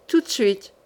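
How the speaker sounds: ordinary delivery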